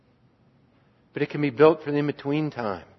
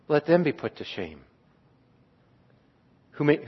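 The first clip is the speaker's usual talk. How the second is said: hushed prayer; 4 dB quieter than usual. Acted. Pastor voicing the psalm to his congregation, hushed prayer. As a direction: pray it quietly, as if alone with God.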